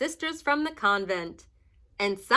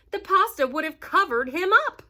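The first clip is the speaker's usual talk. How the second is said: ordinary delivery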